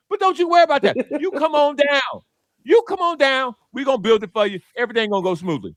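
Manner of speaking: lightly mock an eager host: come on down; city officials as a hammed-up game-show host; game-show invite. Officials voiced reassuring falsely.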